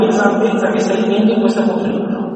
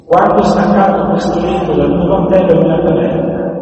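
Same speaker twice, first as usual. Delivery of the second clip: scripture reading; 3 semitones lower than usual. Acted. Preacher reads David pleading with Saul, mildly raised and solemn. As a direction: proclaim David's words with weight.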